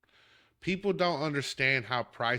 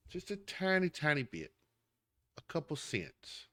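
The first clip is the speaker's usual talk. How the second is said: hushed, dainty little aside; soft, playful understatement; softly minimizing; 3 semitones higher, 7 dB quieter than usual. Acted. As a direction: shrink the voice to make it sound tiny. Acting modest rival seller's small increase.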